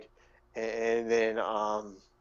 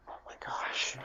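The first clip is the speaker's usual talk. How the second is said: hushed, stunned whisper; upset; 7 dB quieter than usual. Acted. Gnome absorbing news of Falika's death, upset whisper. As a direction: whisper it in shock. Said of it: "you can tell they're really upset".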